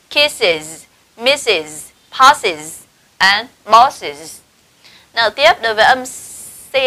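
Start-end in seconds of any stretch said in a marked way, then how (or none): 0.1-4.4 "kisses, misses, passes and bosses", each with a clear -iz; IS plural endings. Shown as a demonstration. Teacher modelling double-s words, "kisses, misses, passes and bosses" ending in -iz. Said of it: "âm is như sau".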